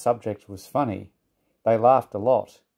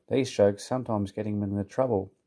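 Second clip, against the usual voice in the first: plain reading voice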